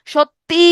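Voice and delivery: সত্যি split, with a long final i; added final i. Shown as a demonstration. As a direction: pause on the ত, then stretch the i of সত্যি. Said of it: "ই ধ্বনিটা কোথায় যুক্ত".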